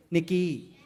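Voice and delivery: Nikki in a Thai accent, tonal. Shown as a demonstration. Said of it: "If we pronounce Nikki in Thai accent".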